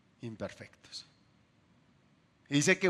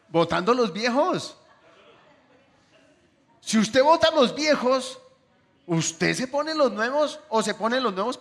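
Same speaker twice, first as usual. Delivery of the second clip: forceful preaching; 8 semitones higher, 7 dB louder than usual. Acted. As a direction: drive the point home like a preacher at full force. Preaching climax on old clothes.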